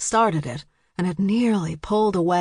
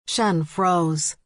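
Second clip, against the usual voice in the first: ordinary delivery, nothing marked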